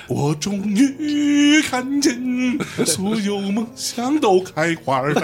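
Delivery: imitating [name]. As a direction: belt it like [name], each word stressed. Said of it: "他说".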